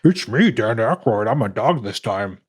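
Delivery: lightly voiced cartoon dog; a hint of a goofy dog voice. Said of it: "as the voice of a dog".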